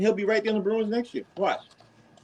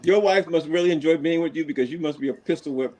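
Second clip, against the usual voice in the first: normal talking voice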